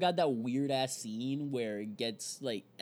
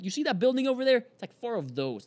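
higher, louder excited call; excited pointing; 9 semitones higher, 5 dB louder than usual. Retold or imitated. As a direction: point it out eagerly. Imagined person pointing out building.

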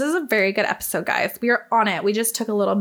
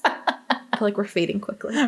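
ordinary talk

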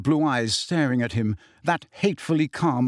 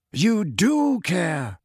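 very slow, higher measured voice; calm old headmaster; 4 semitones higher, much slower than usual. A narrator's reading. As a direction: insist calmly, stretching the words. Dumbledore calmly answers raging Harry.

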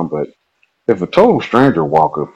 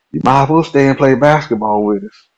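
higher, easy inviting voice; Herman inviting; 8 semitones higher than usual. Retold or imitated. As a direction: play Herman waving him in.

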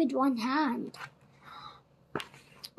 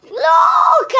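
high, loud, drawn-out cry; excited call; 11 semitones higher, 8 dB louder than usual. A narrator's reading. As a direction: call out to make them look. Girl spots the returning parrot.

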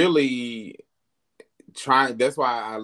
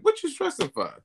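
pep talk to self; faster than usual. Retold or imitated. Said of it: "I talk to myself".

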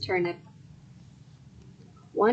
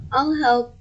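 higher, louder offer to help; eager wife; 4 semitones higher, 5 dB louder than usual. A narrator's reading. Wife sees farmer puffing and panting, eager. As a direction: chime in eagerly.

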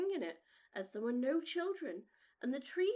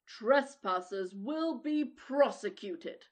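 booming giant voice; 2 semitones lower, 7 dB louder, slower than usual. Acted. Giant's notice board read out.

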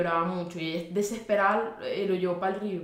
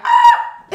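grito agudísimo y fuerte imitando a la Sayona; Sayona scream; 15 dB louder than usual. Retold or imitated. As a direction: chilla como un espanto. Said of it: "la Sayona grita". (grito agudo de la Sayona)

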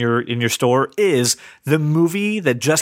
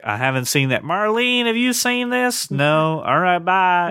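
imitated higher-pitched Southern store clerk; Southern accent; 4 semitones higher, slower than usual. Store clerk calling to a coworker about a soda, Southern accent. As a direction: put on a Southern drawl, pitched up. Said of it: "I don't know why they were Southern".

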